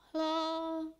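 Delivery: faint cry from afar. Acted. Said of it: "Lalu hilang".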